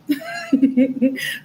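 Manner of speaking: high-pitched giggle; real, amused high laugh. Real, not acted. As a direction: break into a high run of giggles. Laughs after joking warning to viewers.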